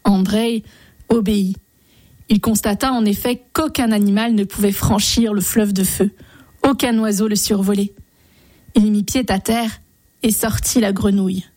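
ordinary delivery

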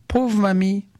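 put-on high child's voice exclaiming in pity; child's cry. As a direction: cry out like a kind little boy. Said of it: "Petit Pierre s'écrie". (voix d'enfant aiguë pleine de pitié)

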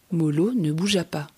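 plain reading voice

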